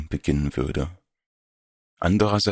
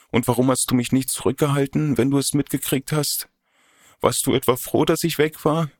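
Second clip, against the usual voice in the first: higher, fast, hurt reproach; upset young woman; 7 semitones higher than usual. Acted. Odette reproaches her lover for not holding her back, climbing hurt. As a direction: accuse him, voice climbing as the hurt grows.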